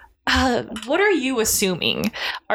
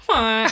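goose honk. Retold or imitated. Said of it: "That's also my goose noise".

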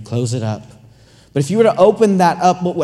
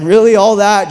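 exasperated; 4 semitones higher, 8 dB louder than usual. Acted. Husband exasperated at wife's outfit.

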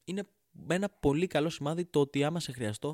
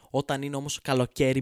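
«Όταν είναι όμως καλοκαίρι» said again louder; loud repeat; 7 dB louder than usual. Shown as a demonstration. Comedian repeating the summer line louder at the audience.

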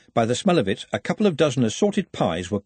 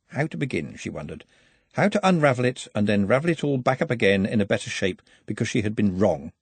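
ordinary delivery, nothing marked

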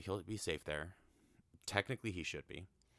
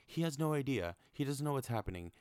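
distressed viewer; 8 semitones higher, 4 dB louder than usual. Real, not acted. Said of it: "you're like".

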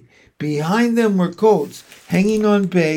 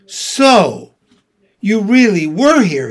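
loud, higher "so" of realisation; accusing; 5 semitones higher, 7 dB louder than usual. A narrator's reading. Peter catches Edmund who had given himself away, accusing. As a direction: confront him, with a weighty pause after the first word.

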